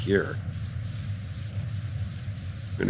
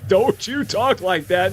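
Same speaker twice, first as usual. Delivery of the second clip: higher, slow scolding of don't you talk like that, with a rise on talk; mock scolding; 9 semitones higher, slower than usual. Streamer mock-scolding herself for fearing a bug, higher and slow. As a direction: playfully scold yourself like a parent. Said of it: "Bella, don't you talk like that".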